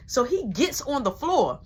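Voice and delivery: ordinary talk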